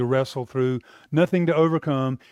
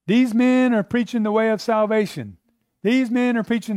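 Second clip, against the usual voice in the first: a hint of the shrieking slave girl; girl crying out; 8 semitones higher than usual. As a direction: call it out like the slave girl, pitched up.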